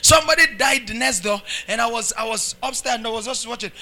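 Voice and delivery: ordinary talk, nothing marked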